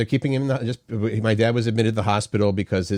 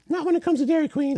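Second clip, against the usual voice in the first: very high put-on voice; exaggerated squeaky imitation; generic somebody-else voice; faster than usual. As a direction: mimic someone in silly falsetto. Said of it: "somebody else voice".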